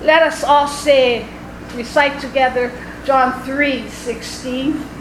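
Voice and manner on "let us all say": usual speaking voice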